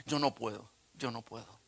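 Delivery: dropping away to a soft repeat; deflated refusal. Retold or imitated. Son giving up after his outburst, quietly deflated. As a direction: let the protest sink and fade.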